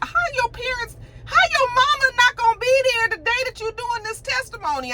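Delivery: way up high, disbelieving; incredulous outrage. Real, not acted. Vlogger reacts to Ozzy's missing mom, high and disbelieving. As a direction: squeal in disbelief at the parents.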